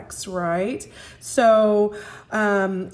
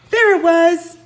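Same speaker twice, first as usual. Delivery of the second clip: much higher, louder burst of relief; excited exclaim; relieved discovery; 8 semitones higher, 9 dB louder than usual. A narrator's reading. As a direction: found it at last. Jack finds the book.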